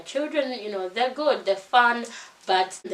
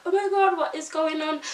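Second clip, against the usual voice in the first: a retold sob of panic, pitched way up; crying out in panic; 8 semitones higher than usual. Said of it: "crying, like".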